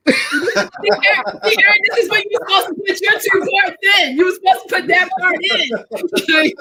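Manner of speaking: laughing while teasing. Real, not acted. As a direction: say it through laughter.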